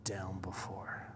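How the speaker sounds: low, hushed admission, fading away; heavy-hearted. Retold or imitated. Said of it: "how this man's feeling".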